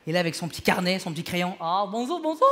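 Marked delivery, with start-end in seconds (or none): 1.6-2.4 imitating waiter. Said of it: "t'as le serveur qui t'attaque".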